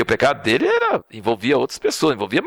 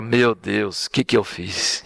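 remorseful; 6 semitones lower, slower than usual. Retold or imitated. David's humble reaction.

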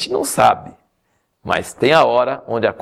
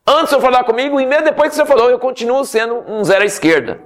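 whiny Moses; 11 semitones higher, faster than usual. Acted. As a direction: moan it like a man sure he's worthless. Moses still refusing God.

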